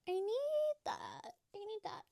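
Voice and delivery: put-on very high, drawn-out little girl plea; whiny little girl. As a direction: beg like a small child. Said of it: "I was like".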